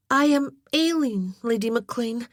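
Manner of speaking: like a child playing at being a lady; formal and stiff. A narrator's reading. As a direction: stiffly recite your own title. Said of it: "She sounded like a child practicing how to be a lady, her voice formal and stiff".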